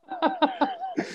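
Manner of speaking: a very high, breathy burst of laughter; squeaky high laugh. Real, not acted. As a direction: let a squeal of laughter escape. Laughing at his own mistake.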